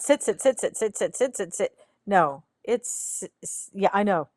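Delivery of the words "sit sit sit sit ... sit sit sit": nagging repeats. Retold or imitated. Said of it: "It's not".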